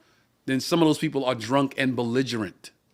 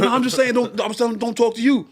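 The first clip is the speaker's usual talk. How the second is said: flustered backpedalling; 9 semitones higher than usual. Retold or imitated. Drunk guy backpedalling.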